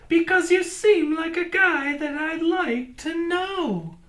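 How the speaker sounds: high crow voice; squawking crow. A narrator's reading. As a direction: crow trying to befriend the buffalo. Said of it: "squawked the mangly old crow".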